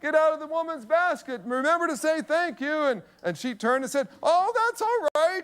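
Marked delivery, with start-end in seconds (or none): 0.0-2.8 high-pitched call down a hallway; mock yell from a distance, pitched up; retold yell. Father to son at hall's end, high mock yell. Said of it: "I yelled over and said". 4.2-5.4 put-on high, drawn-out old lady call; older woman. Older woman to anxious father, high drawn-out reassurance.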